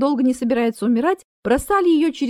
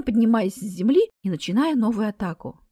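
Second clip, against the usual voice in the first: ordinary delivery, nothing marked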